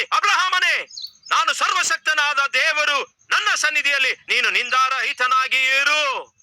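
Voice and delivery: voice of God. Acted. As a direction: boom it out as God appearing suddenly. God suddenly appears, speaks.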